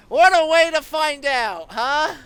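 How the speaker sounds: excited. Real, not acted. Reacts to game twist.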